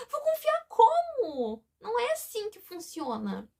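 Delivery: a gasp, then high, slow words through real tears; real sobbing disbelief, then a steadier protest; crying. Real, not acted. Breaks down retelling the argument.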